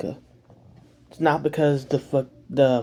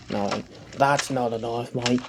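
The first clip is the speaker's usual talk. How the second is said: slow, soft mock Australian accent, Crocodile Dundee style; 4 dB quieter than usual. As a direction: drawl it like a bushman in an Australian accent. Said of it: "You like my Australian accent?".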